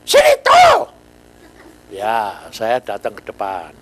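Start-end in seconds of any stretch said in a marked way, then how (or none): 0.0-0.9 very high, loud furious command; teacher shouting a pupil to the front; angry teacher. Retold or imitated. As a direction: bark the order like a livid teacher. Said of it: "Wah, gurunya marah".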